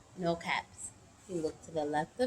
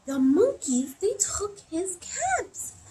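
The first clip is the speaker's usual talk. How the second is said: high, drawn-out storytime shock; astonished; 11 semitones higher, 3 dB louder, slower than usual. Acted. Storyteller discovers the monkeys with the caps, astonished. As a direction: exclaim it high and slow for the children.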